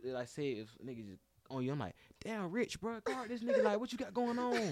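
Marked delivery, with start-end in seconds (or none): 2.2-4.7 high-pitched mock teammate griping; hammed-up complaint pitched way up; nagging teammate. Teammates getting mad at a player getting cooked, mock nagging.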